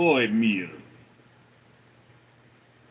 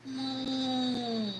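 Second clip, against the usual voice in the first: much higher, softer pleading meows; high soft mewing; whiny cat meows; 11 semitones higher, 8 dB quieter, much slower than usual. Retold or imitated. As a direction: mew softly like a cat who wants down. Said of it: "she'd be like".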